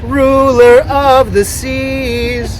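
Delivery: loud, very slow, drawn-out invocation; grand ceremonial voice. Pretend. Boat owner invoking the sea god at a renaming, grand ceremonial voice. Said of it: "Should I do it in a good voice?".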